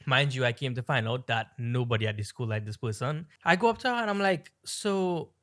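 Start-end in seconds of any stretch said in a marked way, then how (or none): none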